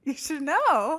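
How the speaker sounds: loud, high comeback; emphatic retort. Pretend. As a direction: raise your voice and pitch to insist. Repeats the retort, much louder.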